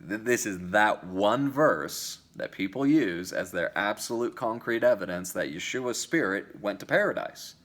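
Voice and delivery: normal talking voice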